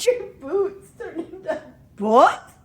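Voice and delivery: high laughing question; slow, laughing, cracking up. Real, not acted. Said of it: "laughing disbelief".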